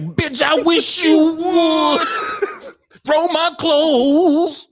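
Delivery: slow, high, laughing singer voice; R. Kelly impression. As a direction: drag it out high and shouting through laughs. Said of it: "He's like".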